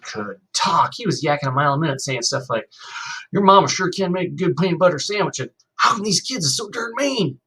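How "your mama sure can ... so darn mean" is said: put-on high, loud, fast-talking country boy; Rufus, chatty new kid. A narrator's reading. New kid chattering to Kenny over lunch, high eager country voice. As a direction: yak away like an eager Southern kid.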